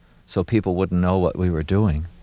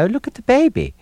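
high, sing-song Baltimore accent demo; showing off a Baltimore accent, cooing at a baby; 3 dB louder, faster than usual. Shown as a demonstration. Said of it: "an intonation that sort of sounds like that".

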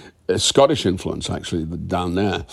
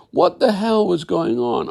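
indignant question; 9 semitones higher, slower than usual. Retold or imitated. Retelling confronting the showrunner.

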